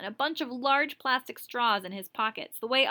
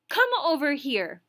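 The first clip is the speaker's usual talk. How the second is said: firm, louder command; stern order; 6 semitones higher, 6 dB louder than usual. Acted. Teacher summoning the pupil, firm loud order. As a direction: call him up to the desk, no arguing. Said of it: "the teacher insisted".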